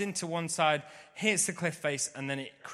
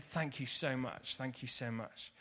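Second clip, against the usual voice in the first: sincere thanks; 2 semitones lower, 9 dB quieter than usual. Retold or imitated. Grateful thanks to the generous surfer.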